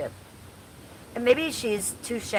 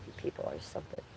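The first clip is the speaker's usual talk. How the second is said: whispered aside; 14 dB quieter, faster than usual. Real, not acted. Muttered aside about viewers.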